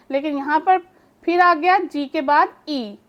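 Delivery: ordinary delivery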